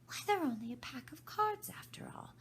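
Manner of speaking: breathless aside, under the breath; whispered to herself. Acted. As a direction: reassure yourself in secret. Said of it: "she added to herself".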